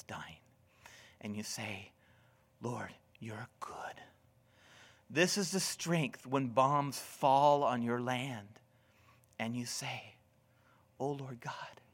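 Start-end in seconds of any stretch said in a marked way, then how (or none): 2.6-4.0 whispered prayer. Pretend. Grieving believer at deathbed prays. 11.0-11.8 whispered prayer. Pretend. Believer under bombs prays.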